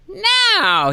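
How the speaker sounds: very high squeaky no; boss's high no. Retold or imitated. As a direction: yelp it like a dismissive boss. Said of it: "he does that when he says something".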